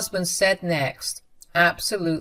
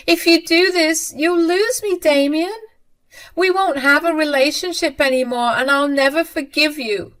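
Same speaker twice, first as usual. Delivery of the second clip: much higher, louder desperate warning; desperate threatening mother; 10 semitones higher, 6 dB louder than usual. A narrator's reading. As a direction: threaten her son out of desperation.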